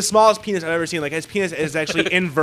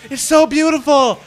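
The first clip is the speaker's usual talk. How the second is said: high, loud blissed-out gushing; imitated drugged rapture; 8 semitones higher, 7 dB louder than usual. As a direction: gush like a man tripping on LSD. Imitating drugged naked man enraptured.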